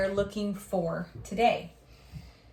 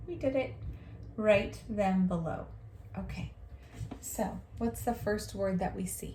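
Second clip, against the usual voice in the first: usual speaking voice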